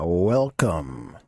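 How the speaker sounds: low drawn-out villain welcome; creepy welcome. Acted. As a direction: greet them like a horror host. Spooky greeting opening.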